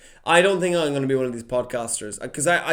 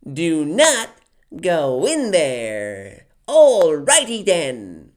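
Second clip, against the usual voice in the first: slow, drawn-out, louder cartoon goofball voice; Ace Ventura impression; 4 semitones higher, 7 dB louder, much slower than usual. Child copying the Ace Ventura cartoon, in a slow goofy voice. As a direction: stretch every word like a cartoon pet detective. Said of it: "So I was like".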